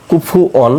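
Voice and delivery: recited in Quranic Arabic, কুফু অন sounded out clearly; Quran recitation. Shown as a demonstration. Teacher reciting কুফু অন for students. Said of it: "কাপ পেশ কু".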